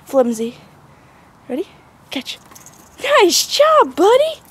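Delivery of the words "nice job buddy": high squeaky dog praise; squeaky praising a dog. Real, not acted.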